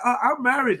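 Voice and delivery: high, coy mock protest; mock coy old woman. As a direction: play the flattered lady, lightly. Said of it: "She's like".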